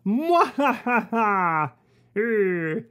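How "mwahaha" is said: evil laugh. Acted. Villain gloats after catching them.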